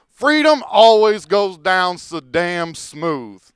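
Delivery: slow, loud, mock-savouring; sarcastic toast. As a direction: savour it like fine whiskey. Sarcastic toast while drinking.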